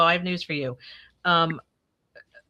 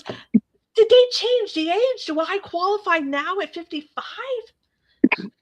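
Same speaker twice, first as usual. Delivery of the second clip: high, very slow, hopeful put-on voice; mock eager question; 13 semitones higher, much slower than usual. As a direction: ask it like a thrilled fool. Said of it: "he goes".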